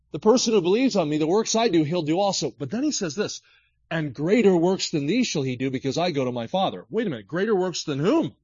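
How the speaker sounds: fiery sermon delivery; full-force preaching. Acted. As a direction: preach it with fire.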